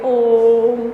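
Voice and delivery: ओम drawn out very long; sustained demonstration ओम, like a chant; prolonged vowel. Shown as a demonstration. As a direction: hold the O in ओम, then hum into the m. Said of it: "ये इतना हम बोलते हैं".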